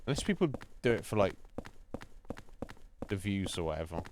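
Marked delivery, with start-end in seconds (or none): none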